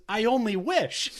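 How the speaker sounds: high, loud, slow delivery; deadpan punchline. Retold or imitated. Quoting [name]'s joke loudly and slowly. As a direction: land the punchline big. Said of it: "And he goes".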